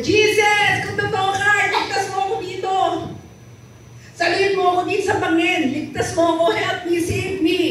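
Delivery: high, pleading cry to Jesus; desperate prayer. Acted. Atheist on the cliff begs Jesus to save him, high pleading cry. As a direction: beg to be saved, voice up high. Hiker begging God from cliff.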